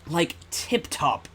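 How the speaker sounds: slow mock British accent saying Tip Top. Streamer mocking the game's fake Britishness, slow mock British accent. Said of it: "this was made by Americans".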